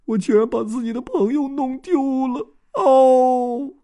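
high, wailing grief; grief-stricken. Acted. Dodds collapsing in grief, a high wail. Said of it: "他伤心过度".

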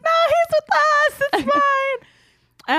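very high, squealed through laughter; cackling. Retold or imitated. Sister at escalator top to stranger, imitated cackling shout. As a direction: imitate helpless cackling while calling out. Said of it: "still cackling".

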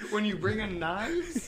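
incredulous parroting of a friend; mock incredulous. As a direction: repeat it slowly in loud, laughing disbelief. Mocking repeat of friend's line.

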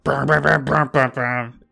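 mock music going brum-brum; imitating loud music. Showing co-hosts how loud the music was, imitated blare. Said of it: "the music was just like".

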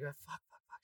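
very soft swearing under breath; quiet dread hearing her throw up; muttered curse. Retold or imitated. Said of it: "I was like".

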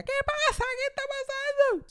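hammed-up cry of alarm, high and stretched; alarmed grandmother. Grandmother calling from the bath window, alarmed shriek.

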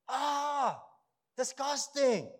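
a high, loud, hammed-up cry of disgust; mock disgust. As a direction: recoil at the thought, playing it up. Acting out disgust at a possum.

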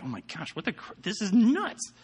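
shocked. Retold or imitated. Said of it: "and they're like".

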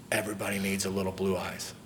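quiet, hushed and gentle, leaning on "blue"; mother's soft last words. Retold or imitated. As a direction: softly relive a dying mother's whisper-like words. Mother's last words, tenderly retold.

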